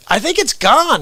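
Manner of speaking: high-pitched playful kidding; joking. Retold or imitated. Man kidding an older neighbour about the virus, joking. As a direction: pitch it way up as a put-on claim. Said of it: "I jokingly said to him".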